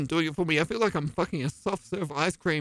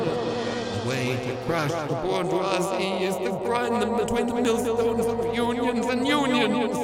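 ordinary talk

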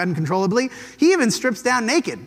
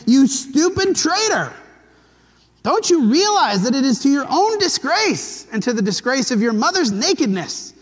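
furious outburst; 6 semitones higher, 4 dB louder than usual. Retold or imitated. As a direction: shout at the son who betrayed you. Saul rages at Jonathan.

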